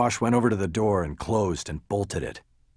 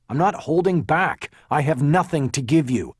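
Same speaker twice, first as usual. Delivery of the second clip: higher, louder firm denial; emphatic insistence; 7 semitones higher, 5 dB louder than usual. A narrator's reading. Lawyer insists to detective he's got nothing, emphatic. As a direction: drive the denial home.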